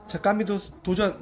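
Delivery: ordinary delivery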